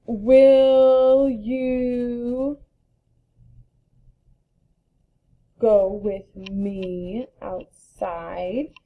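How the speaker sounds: drawn-out, word-by-word sentence; slow example read. Shown as a demonstration. Teacher modeling an example sentence for kids, very slow. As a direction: stretch each word for the class.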